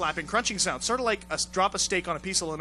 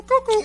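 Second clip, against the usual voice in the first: cuckoo squeaked very high and loud; cartoon cuckoo sound effect; mock cuckoo call; 7 dB louder than usual. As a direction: squawk it like a cartoon clock.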